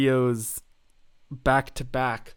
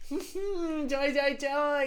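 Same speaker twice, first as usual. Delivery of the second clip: sarcastic joy; 11 semitones higher, much slower than usual. Acted. Sarcastic cheer after cringe.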